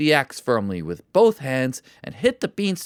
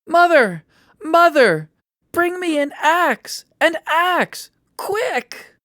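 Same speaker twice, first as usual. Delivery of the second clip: high, loud desperate shouting; 6 dB louder, slower than usual. A narrator's reading. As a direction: shout for help. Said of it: "he shouted to his mother".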